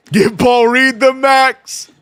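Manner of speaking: high, loud, drawn-out cheer; big hype-man holler; excited shout. Real, not acted. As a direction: shout it out slowly like a hyped fan. Shouts in excitement after big play.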